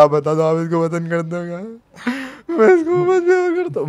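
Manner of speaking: high-pitched tearful wail, hammed up; mock sobbing. Storyteller mimicking boy confessing love for his friend, mock sobbing. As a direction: break into an exaggerated crying plea. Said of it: "भाई, रो मत तू भाई".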